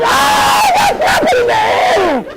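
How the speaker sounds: very high shouted shock; shocked realization. Retold or imitated. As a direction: shout it out in sudden horror. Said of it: "I was like".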